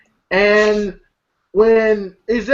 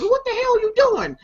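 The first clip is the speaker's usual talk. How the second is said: high-pitched mock disbelief; imitated outraged question; 14 semitones higher than usual. Retelling a friend confronting a faker, high mock outrage. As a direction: pitch it way up, as if baffled. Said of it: "And this boy goes".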